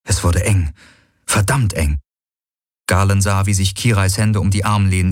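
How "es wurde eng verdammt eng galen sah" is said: ordinary delivery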